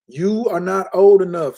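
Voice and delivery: ordinary delivery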